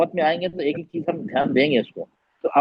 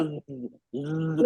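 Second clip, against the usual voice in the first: slow, stretched ज़ sound; drawn-out buzzing z; 2 semitones lower, 8 dB quieter than usual. Shown as a demonstration. Teacher slowly shows students the buzzing sound. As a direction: hold the ज़ out slowly like a bee. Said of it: "buzzing sound देखो".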